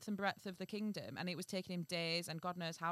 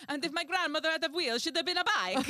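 loud, high Italian-accent impression; Gino D'Acampo; 10 semitones higher, 10 dB louder than usual. Imitating a famous TV moment, high Italian-accented outburst. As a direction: exclaim indignantly in a hammed-up Italian accent. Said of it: "where he's like".

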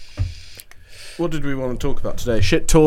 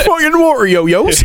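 put-on Norfolk accent; 12 semitones higher, 3 dB louder than usual. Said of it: "excuse my Norfolk accent".

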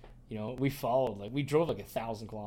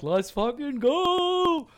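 much higher and louder, slowly stretched out; hyped yell; 10 dB louder, much slower than usual. Retold or imitated. Hunter hyping his crew before the hunt, stretched high yell. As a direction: drag it out like a pumped-up battle cry.

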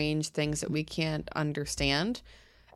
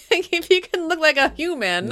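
laughing; 10 semitones higher, 6 dB louder than usual. Real, not acted. Laughing disbelief at eyes.

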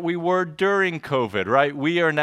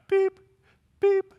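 very high, short electronic beeps; imitated beep. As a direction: chirp each beep like a machine. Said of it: "you hear this background".

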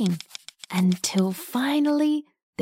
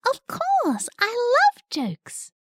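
very high eager bug voice; excited little caterpillar; 12 semitones higher, slower than usual. Acted. Squish answers joke offer.

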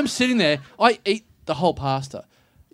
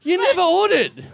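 much higher, laughing mock vendor voice; imitating caravan staff; 11 semitones higher than usual. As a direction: deliver it like a baffled food vendor. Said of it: "And they're like".

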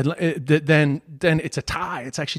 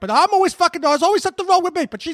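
much higher, pushed ranting tough-guy voice; Michael Rappaport rant; 8 semitones higher, faster than usual. Retold or imitated. Host answers the co-host's jab in his Rappaport voice, high indignant rant. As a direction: whine about the perfect woman like a bitter actor. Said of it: "my Michael Rappaport sounds like Bill Burr".

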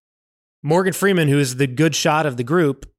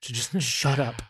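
weary irritation; 7 dB quieter than usual. Retold or imitated. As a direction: grumble it under your breath, mock annoyed. Irritated reply to pestering kid.

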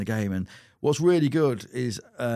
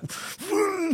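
high laugh. Real, not acted. Laughs at the joke.

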